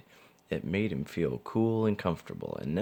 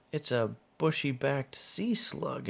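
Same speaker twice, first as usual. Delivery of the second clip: high, slow excited exclamation; exclaiming; 8 semitones higher than usual. A narrator's reading. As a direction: announce the find with excitement. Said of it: "exclaimed the other".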